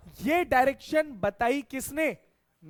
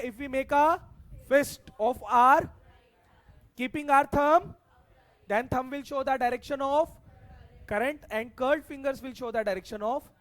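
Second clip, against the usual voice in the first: loud opening, slow chunks with hanging ends; prompting recital; 4 dB louder, much slower than usual. Real, not acted. Teacher quizzing students on the rule, slow prompting recital. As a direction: recite the rule slowly, pausing for the class to follow.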